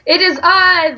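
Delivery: high, loud showman hello; loud greeting. Acted. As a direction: call out the hello to the audience. Video intro greeting to viewers.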